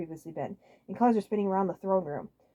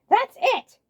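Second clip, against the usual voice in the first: loud exasperated yell; frustrated outburst; 10 dB louder than usual. Acted. Wario losing patience, yelling out loud. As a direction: explode with impatience.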